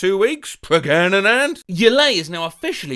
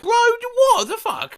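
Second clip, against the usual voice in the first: much higher, slower, stunned what the fuck; disbelieving cry; slower than usual. Real, not acted. Reacting to the bot's nonsense, high stunned disbelief. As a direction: pitch shoots up in sheer disbelief.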